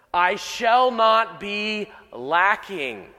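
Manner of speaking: very slow, each word weighed; slow emphatic reading. Shown as a demonstration. Preacher slowly reads the literal Hebrew line. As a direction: let the literal phrase sink in. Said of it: "Literally in the Hebrew".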